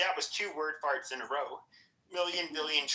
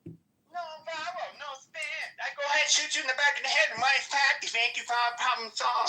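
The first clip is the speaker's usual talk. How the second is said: high put-on caricature voice; mock dictator; 8 semitones higher, 3 dB louder than usual. Listener mocking the candidate's mantra, high caricature voice. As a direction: parody the slogan as a cartoon tyrant.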